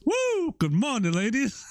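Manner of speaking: high whooping greeting; cheeky catcall. Pretend. Joking about James greeting the girls, a high whoop. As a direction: whoop it at the ladies.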